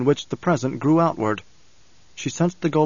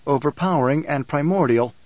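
ordinary delivery

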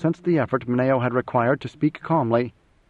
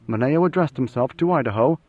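ordinary delivery

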